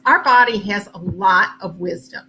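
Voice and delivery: ordinary talk, nothing marked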